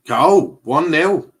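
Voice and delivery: loud, high burst of joy; excited goal shout. Real, not acted. Calling a goal to viewers during the match, excited shout. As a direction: shout it out like the ball just went in. Kane scores, he calls it.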